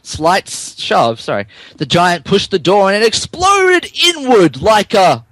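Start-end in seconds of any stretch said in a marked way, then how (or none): none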